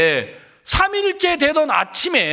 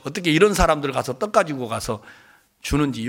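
normal talking voice

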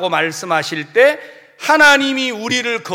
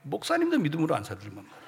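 ordinary delivery

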